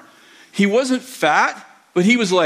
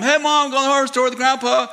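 calling out to mom; 7 semitones higher than usual. Retold or imitated. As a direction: kid calling out to another room, pitch raised. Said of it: "So I yelled at my mom".

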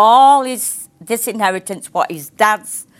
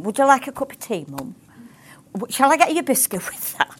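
put-on quick, sweetly wheedling child asking a favour; butter mum up fast, all innocence; wheedling son; 5 semitones higher, 4 dB quieter, faster than usual. Son Adam wheedling his mum.

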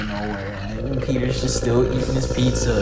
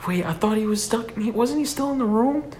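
confused alarm; 11 semitones higher than usual. Retold or imitated. Friends realize guy is missing.

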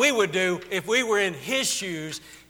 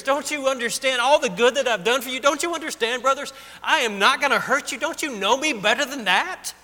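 tearful plea; 5 semitones higher, 3 dB louder than usual. Acted. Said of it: "He just starts crying".

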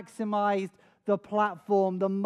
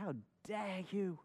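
acted quiet outrage, far softer; hushed reproach; 12 dB quieter than usual. David reproaching the celebrating messengers.

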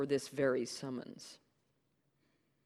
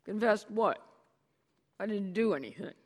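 higher, indignant innocence; defensive denial; 7 semitones higher, 5 dB louder than usual. Acted. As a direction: play dumb, a bit loud.

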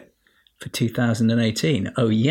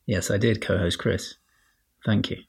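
ordinary talk

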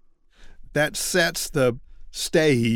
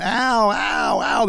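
higher, hammed-up little ow cries; mock ow ow ow of a crick in the neck; mock cries of pain; 6 semitones higher, slower than usual. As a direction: play a man whining about his sore neck. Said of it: "grabbing his neck going".

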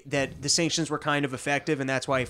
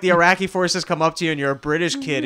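ordinary talk

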